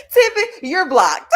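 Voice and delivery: loud, high words through laughter; laughing mock scold. Real, not acted. Host jokingly blocking Tippy, laughing loudly. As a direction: scold loudly while cracking up.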